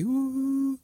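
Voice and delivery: high, drawn-out impressed oh; a woman's voice letting out a high oh. Retold or imitated. Imitating a woman's high oh after hearing the pitch. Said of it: "میشنوی".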